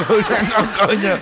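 high, slow, giggling mock quote; teasing punchline, high and slow with a laugh; laughing mock line. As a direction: squeal it out through laughter. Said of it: "mocking the punchline, laughing".

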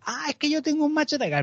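a hint of the eager stranger; mock woman. Stranger telling a dog walker about her dog, lightly high.

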